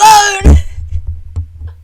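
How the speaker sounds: loud, high wailing sob; hammed-up sobbing wail. [name] wailing at Groven, hammed-up sob. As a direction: let out a fake wail.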